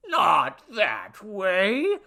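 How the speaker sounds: loud, high, drawn-out protest; furious theatrical shout; enraged. A narrator's reading. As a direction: roar each word slowly in outrage. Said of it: "The god hopped with rage".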